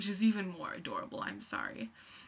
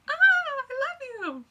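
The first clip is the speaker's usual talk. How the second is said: very high, very loud, slow scream; fangirl scream; 13 dB louder, slower than usual. Retold or imitated. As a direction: scream at your teen idol. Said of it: "like my teen idol".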